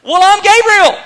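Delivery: high, loud angel's comeback; forceful, indignant self-announcement; emphatic retort. Acted. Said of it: "spoke back to him in the same emphatic language".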